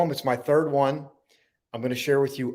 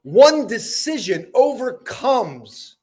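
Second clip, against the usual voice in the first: slow, emphatic spoken-word delivery, high attacks falling away; dramatic poem recital; 7 semitones higher, 5 dB louder, slower than usual. Acted. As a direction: declaim each line, pausing before the last words. Recites own motivational poem.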